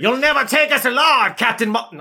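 high, loud British villain; mock British bad guy yelling. Villain defying Captain Marvel, British and yelled. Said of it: "I don't know why he's British".

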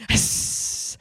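unvoiced long hiss like a dinosaur. Dinosaur hisses at the survivors, a long unvoiced hiss. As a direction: hiss through the teeth at the group.

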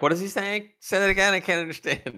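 high, loud baffled complaint; mock bafflement. Teasing co-host about thick accents, mock bafflement. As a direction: play a listener who can't follow the accent.

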